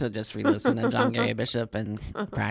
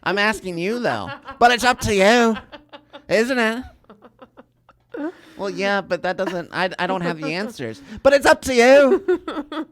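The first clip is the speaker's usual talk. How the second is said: Scottish brogue back-and-forth; 11 semitones higher, 13 dB louder than usual. Retold or imitated. Retelling a boundaries talk with the coach, in a loud Scottish brogue. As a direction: replay the exchange in a hammed-up brogue. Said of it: "Scottish brogue".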